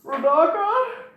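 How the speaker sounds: a cowardly plea for help, high and drawn out; mock whimpering call to his partner; whiny pleading king. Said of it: "Sidorak was like".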